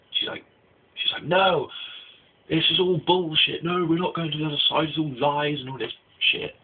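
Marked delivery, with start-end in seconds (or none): 1.2-6.6 high, mock-indignant protest; retold outburst in a raised pitch; angry refusal. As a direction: mimic someone angrily refusing, pitched up. Acted angry refusal during retold fight.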